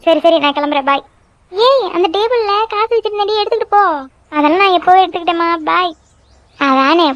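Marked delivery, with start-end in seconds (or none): 1.5-3.8 high-pitched mother calling out; calling after someone. Acted. Mother reminding daughter to take the money, calling out. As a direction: raise the pitch for a parting reminder.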